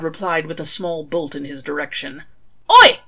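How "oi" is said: very high, loud startled "Oi!"; startled yelp. A narrator's reading. Crawley dodging a lightning bolt, startled yelp. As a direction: yelp as the bolt flies past.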